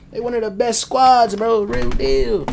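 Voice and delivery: excited hype. Real, not acted. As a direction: get carried away hyping your team. Worked up hyping the Bulldogs.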